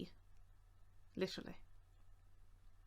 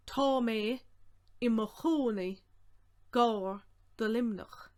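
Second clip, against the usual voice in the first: projected, in short separate chunks; deliberate phrasing with pauses; slow breakdown; 4 dB louder, slower than usual. Shown as a demonstration. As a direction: break it up slowly for learners.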